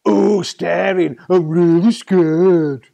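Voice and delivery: loud, slow and sneering; mock him with fake fright; sarcastic mock fear. A narrator's reading. Tiger mocks the stern man.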